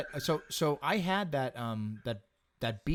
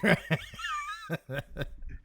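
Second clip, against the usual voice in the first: high laugh; 3 dB quieter than usual. Real, not acted. Big laugh.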